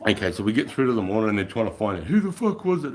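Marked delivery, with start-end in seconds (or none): 2.1-2.9 high, loud who was it; mock interrogation; demanding officer.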